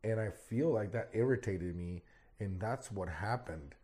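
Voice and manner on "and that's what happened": usual speaking voice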